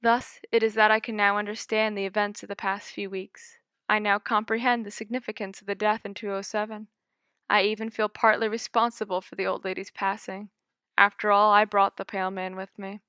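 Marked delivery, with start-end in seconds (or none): none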